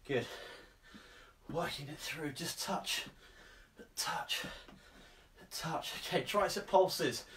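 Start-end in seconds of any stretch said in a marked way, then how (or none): 1.5-5.9 breathy whisper, coaching softly; whispered cues. Real, not acted. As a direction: whisper the reps under your breath. Coach cueing softly during exercise.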